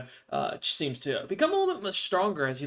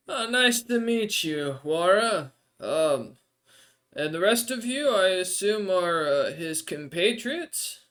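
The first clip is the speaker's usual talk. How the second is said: higher, slower strange desert dweller, polite; NPC voice; 5 semitones higher, 4 dB louder, slower than usual. Acted. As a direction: lift into the odd creature's voice, grateful but hungry.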